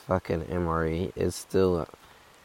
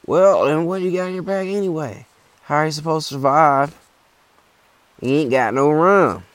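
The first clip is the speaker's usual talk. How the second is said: put-on high, loud old hiker voice; hammed-up high old man; old guy voice; 9 semitones higher, 6 dB louder than usual. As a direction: put on the old-timer demanding rum. Old guy voiced in hiking story.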